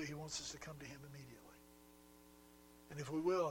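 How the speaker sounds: ordinary talk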